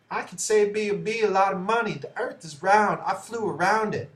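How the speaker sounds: put-on quoting the astronaut in a high, loud southern accent. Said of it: "It was, like, a southern".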